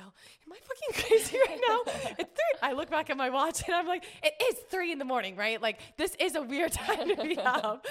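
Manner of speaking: high, loud, incredulous rant; comic panic with laughter breaking in; retold freak-out. Woman alone in tent at night, exasperated panicky squeal.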